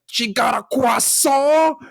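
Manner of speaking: high, drawn-out mocking French pronunciation; mock outrage. Boyfriend mocking French woman to girlfriend, high scandalized jeer. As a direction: stretch it high and incredulous, mimicking the accent.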